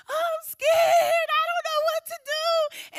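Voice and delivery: high, loud and slow, a panicked sob; crying, scared. Retold or imitated. Calling her mother-in-law from the police car, crying in terror. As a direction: wail it out through tears, terrified.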